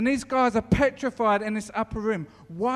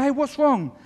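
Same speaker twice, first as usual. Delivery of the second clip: concerned call; 3 semitones higher, 7 dB louder than usual. Retold or imitated. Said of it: "But he goes".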